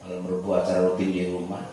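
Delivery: soft, low tired voice. Real, not acted. Exhausted preacher admits he has not eaten, soft and low. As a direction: let the fatigue sag through every word. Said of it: "Karena capek tuh".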